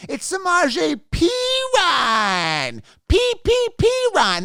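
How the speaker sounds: high, slow, shouted mock correction; mocking know-it-alls. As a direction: ham up the snooty correction, dragging and yelling the name. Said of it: "and then they say".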